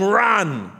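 loud, high cheering yell; imitated yell. Preacher voices Abraham cheering the runner, yelling. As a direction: yell it like a fan on his feet. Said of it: "he's on his feet yelling".

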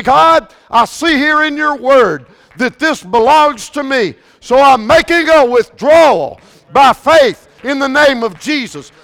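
loud, high claiming prayer; shouted declaration of faith; bold faith prayer. Retold or imitated. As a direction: demand it boldly from God. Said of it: "you say".

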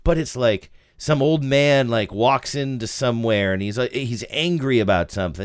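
normal talking voice

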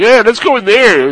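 loud, high horror-movie victim; hammed-up eagerness; mock eager. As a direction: pipe up as the foolishly keen character. Voices horror movie victim going in.

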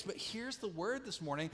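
normal talking voice

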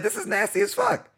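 a squeaky, grossed-out thought; disgusted inner voice. Retold or imitated. Tasting the nasty pub beer, a high disgusted inner voice. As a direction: shoot way up high in pitch, appalled.

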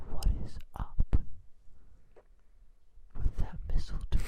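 breathy whisper into the mic. Pretend. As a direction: whisper it right into the listener's ear. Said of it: "nice little whisper in your ear".

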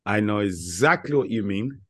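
normal talking voice

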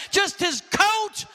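usual speaking voice

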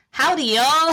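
a hammed Texas accent, tried on request. Shown as a demonstration. British guest asked for her best Texas accent, attempted Texas accent. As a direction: attempt a Texas drawl greeting. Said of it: "Texas is".

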